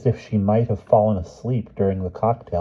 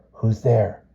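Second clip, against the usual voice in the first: whispered; 3 semitones higher than usual. A narrator's reading. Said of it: "Cousin William whispered quickly".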